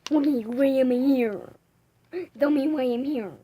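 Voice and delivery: loud, demanding put-on voice. Asking a friend why they are here, loud put-on voice. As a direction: play a character demanding answers, very loud. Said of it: "why are you talking like this".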